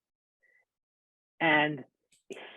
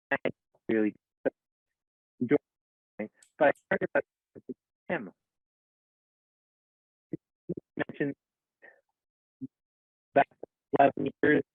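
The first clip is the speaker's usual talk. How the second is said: choppy call audio dropping out; words cutting in and out over a bad connection; audio breaking up; faster than usual. Real, not acted. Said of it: "Your audio is breaking up".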